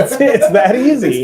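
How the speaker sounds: high, slow laughing tease; laughing mock. Mocking repeat, laughing.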